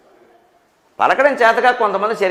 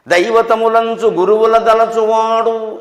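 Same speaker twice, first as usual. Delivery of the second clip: slow chanted verse line, higher pitched; verse recitation; 4 semitones higher, much slower than usual. Shown as a demonstration. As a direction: recite it slowly with drawn-out syllables.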